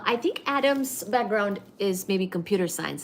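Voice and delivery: ordinary talk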